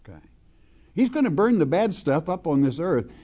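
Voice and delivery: usual speaking voice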